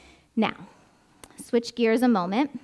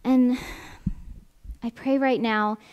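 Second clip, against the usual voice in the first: prayer. Acted. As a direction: pray gently over the room. Leads closing prayer.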